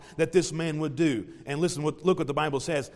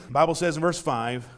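usual speaking voice

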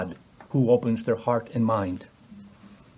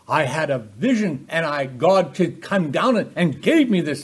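hammed-up vision tale, high and swooping; mock excited; 4 semitones higher, 3 dB louder than usual. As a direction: play an excited visionary in mockery. Mock account of Peter's supposed vision.

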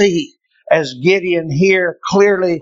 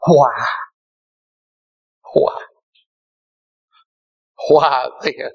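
fading cry of why; 2 semitones lower than usual. Retold or imitated. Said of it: "to cry out".

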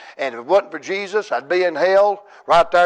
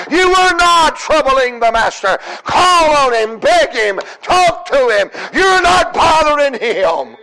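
high, loud, rising preacher's cry; full-force preaching; 12 semitones higher, 5 dB louder, slower than usual. Acted. Preacher urging the congregation to call on God, full-force preaching. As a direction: preach it out to the whole church, pleading.

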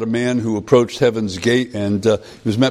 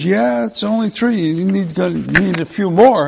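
much higher, matter-of-fact angel; 8 semitones higher than usual. Retold or imitated. Angel tells the man he needs more points, high voice. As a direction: keep the angel light and high.

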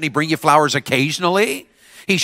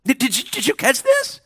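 ordinary talk

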